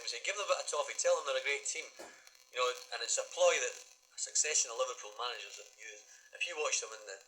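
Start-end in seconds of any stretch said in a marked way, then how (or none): 0.3-1.9 higher, light Geordie imitation of the old manager; a hint of Geordie, higher and brighter in pitch; Geordie accent. Manager telling players to flatter the opponents, light Geordie accent. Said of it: "in his little Geordie accent".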